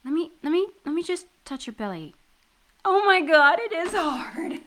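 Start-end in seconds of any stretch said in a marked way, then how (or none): 2.8-4.6 high, loud, laughing amazement; excited exclamation. Acted. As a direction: gush with giddy disbelief. Touching his inflated belly.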